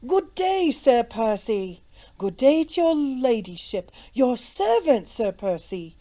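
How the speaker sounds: loud, high-pitched hailing; chorus of greeting. A narrator's reading. Crowd outside greets important guests, loud chorus. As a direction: call out welcomes to arriving gentry. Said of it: "was heard in one long continued chorus".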